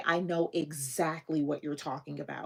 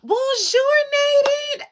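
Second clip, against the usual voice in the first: high, loud delighted hello; excited greeting; 13 dB louder than usual. Real, not acted. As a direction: greet her with a big burst of joy.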